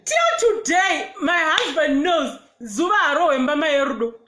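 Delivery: laughing through the words. Real, not acted. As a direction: laugh it out, half crying. Laughing while telling a story.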